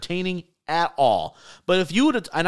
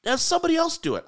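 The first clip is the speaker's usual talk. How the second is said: exasperated; 12 semitones higher than usual. Real, not acted. Exasperated rant, raised pitch.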